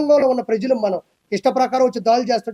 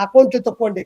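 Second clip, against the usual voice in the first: ordinary delivery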